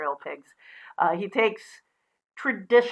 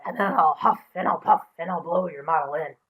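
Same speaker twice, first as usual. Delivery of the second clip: wolf voice; 3 semitones lower than usual. Acted. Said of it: "I'm not very good at doing voices".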